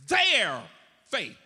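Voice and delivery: "Their" loud, high and drawn out, a beat before "faith"; stress on THEIR. Shown as a demonstration. Preacher driving home the text's emphasis, stress on "Their". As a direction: punch "Their", pause, drop onto "faith". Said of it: "the emphasis is on their faith".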